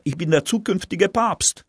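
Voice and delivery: put-on high boy's voice, insisting; a Polish boy claiming he'll be Pope; boy calling out. A narrator's reading. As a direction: squeak it out as a proud young boy. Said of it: "ruft der Pole".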